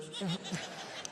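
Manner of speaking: soft huff of laughter; quiet breathy laugh. Real, not acted. Speaker amused by the pie joke, soft laugh.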